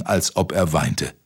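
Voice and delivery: ordinary delivery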